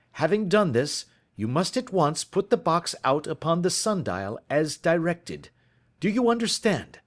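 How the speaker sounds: detective pressing for evidence; Holmes urgent. Acted. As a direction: urge him on, impatient. Holmes's dialogue voice.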